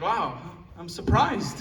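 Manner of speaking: slow, deadpan-sarcastic wow; mock surprise. Dad talking smack about Wordle, sarcastic.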